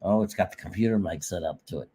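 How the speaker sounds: faint computer mic; barely audible. Real, not acted. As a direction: sound far from the mic. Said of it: "you sound a little faint".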